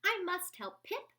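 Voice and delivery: high excited voice; eager. A narrator's reading. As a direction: bubble over with eagerness. Eager young bat.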